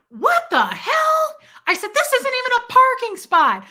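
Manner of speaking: high, loud indignant yell. Retold or imitated. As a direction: scream it across the parking lot. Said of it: "I got super loud".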